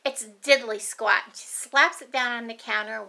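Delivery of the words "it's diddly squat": put-on louder, sassy little girl; a touch of kid attitude; mild cheeky child voice. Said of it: "She goes".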